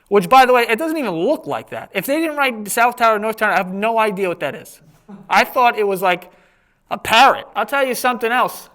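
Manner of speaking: normal talking voice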